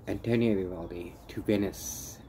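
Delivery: ordinary talk, nothing marked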